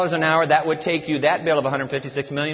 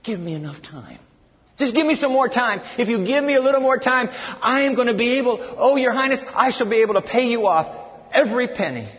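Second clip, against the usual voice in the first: pleading servant; 7 semitones higher than usual. Retold or imitated. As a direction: plead for more time, pushing it up high. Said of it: "You know what he asked for?".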